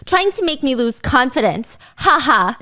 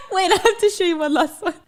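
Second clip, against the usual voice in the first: normal talking voice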